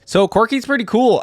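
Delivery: bright, loud burst of enthusiasm; excited. Real, not acted. Reacting to the kit, excited and loud. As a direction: sell it like you really mean it.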